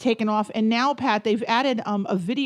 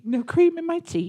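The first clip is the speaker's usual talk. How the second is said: higher mock-British refusal; put-on British accent; 5 semitones higher than usual. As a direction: echo it in a silly British accent, pitched up. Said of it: "I'll do the rest of the show in an accent".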